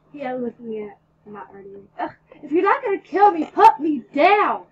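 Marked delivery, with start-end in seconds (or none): 2.0-4.7 yelling to be let down; screamed. A narrator's reading. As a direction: scream to be put down. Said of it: "I screamed".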